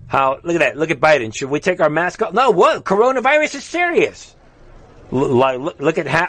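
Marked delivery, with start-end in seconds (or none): 1.3-4.3 high, mock-innocent squeaky voice; mocking leader voice. Host mocking G20 leaders' mask talk, squeaky mock voice. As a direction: ham it up as a clueless leader, much higher.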